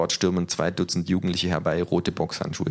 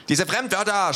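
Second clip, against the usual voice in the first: yelling neo-Nazi; 13 semitones higher than usual. A narrator's reading. Neo-Nazi yells at the foreign words, mild mock shout. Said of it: "schreit er".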